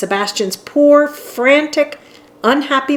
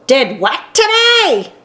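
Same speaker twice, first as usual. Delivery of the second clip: yelling back; 7 semitones higher than usual. A narrator's reading. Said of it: "Huck would yell back".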